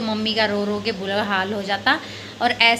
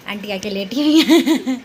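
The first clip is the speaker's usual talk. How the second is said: laughing; 2 semitones higher, 5 dB louder than usual. Real, not acted. Laughing while telling about auntie.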